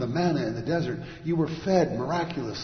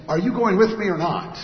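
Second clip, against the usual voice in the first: loud, higher, forceful challenge; tough grizzled leader; 6 semitones higher, 10 dB louder than usual. Acted. Grizzled guy challenging his people, loud and tough. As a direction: throw it out as a tough commander. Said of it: "this tough, grizzled guy up there saying".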